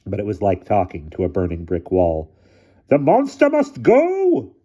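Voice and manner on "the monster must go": slow, very high, forceful shout; drawn-out heckler's yell; shouted demand. A narrator's reading. Blacksmith shouts his demand from down the hill. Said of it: "shouted a deep bass voice".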